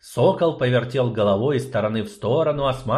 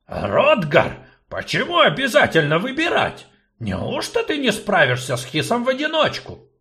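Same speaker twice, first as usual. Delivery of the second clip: louder, with a laugh; laughing gnome; 11 semitones higher, 4 dB louder than usual. Acted. Gnome laughs off the choice. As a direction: gnome chuckling.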